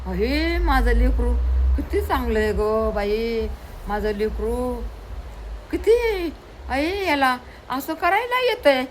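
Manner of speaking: high, gushing fond praise; doting mother. Acted. Mother praises her son, high and gushing. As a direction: gush over the child with delight.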